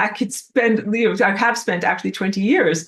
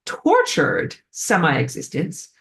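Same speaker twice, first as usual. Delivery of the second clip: usual speaking voice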